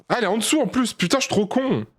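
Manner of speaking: high-pitched self-reproach; panicky, high gamer exasperation; exasperated outburst. Real, not acted. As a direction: cry out in frustration at yourself. Gamer cursing own mistake.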